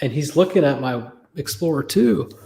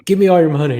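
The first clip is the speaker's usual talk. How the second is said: loud, higher pretend stick-up; mock robber demand; 4 semitones higher, 9 dB louder, faster than usual. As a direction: play a robber shouting a demand. Acts out an imagined robber.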